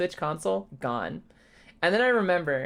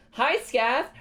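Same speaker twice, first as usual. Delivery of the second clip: ordinary talk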